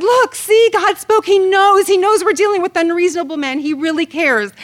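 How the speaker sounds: excited triumph. Retold or imitated. Wife gloating to her husband, excited and loud. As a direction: crow it like God just proved you right. She triumphs after Bible verse.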